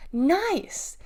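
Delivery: high pleased nice; excited praise. A narrator's reading. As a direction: praise him warmly. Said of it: "Deli said".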